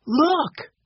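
high eager call; a girl calling out; excited cry. A narrator's reading. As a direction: point it out with excitement. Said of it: "cried Violet".

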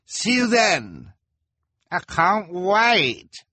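very high, slow, eager voice; high excited spectator. A narrator's reading. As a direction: stretch it out with glee. Spectators say goodbye.

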